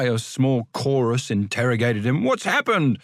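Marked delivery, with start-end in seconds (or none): 2.2-3.0 eager. Acted. Chorus asking eagerly.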